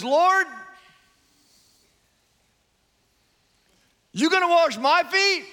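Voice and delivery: high, loud incredulous Peter; Peter's question. Retold or imitated. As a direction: ask it like brash Peter. Peter voiced in retelling.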